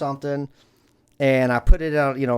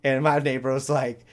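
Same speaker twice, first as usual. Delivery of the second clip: ordinary delivery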